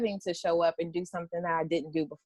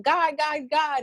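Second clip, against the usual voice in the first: mock exasperated repetition; 8 semitones higher, slower than usual. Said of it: "that was all I was hearing".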